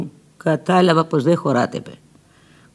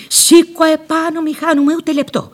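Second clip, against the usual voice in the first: urgent urging; 11 semitones higher than usual. Retold or imitated. Urging Petros to flee.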